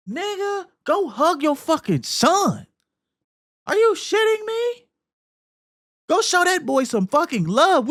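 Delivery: high exasperated shout at the dad; outraged yell. Real, not acted. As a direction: voice shoots up high in disbelief. Yelling at game dad.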